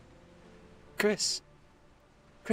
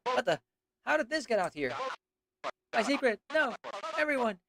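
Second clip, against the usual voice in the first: higher, wounded lament; dramatic character voice; 9 semitones higher than usual. Acted. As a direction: play it as a hurt, lonely creature.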